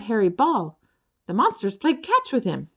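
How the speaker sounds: usual speaking voice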